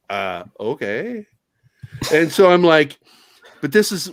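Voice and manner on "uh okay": stunned, soft okay. Retold or imitated. Stunned reply after firing.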